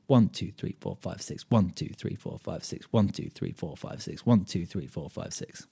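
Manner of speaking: a teacher counting six eight with a strong one; count six eight, accent on one. Shown as a demonstration. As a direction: punch each one, breathe the others. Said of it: "more emphasis on the one".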